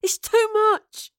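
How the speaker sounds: high, loud, drawn-out cry of distress; slow anguished protest, pitched high; overwhelmed cry. A narrator's reading. Girl overwhelmed by visions in her head, anguished cry.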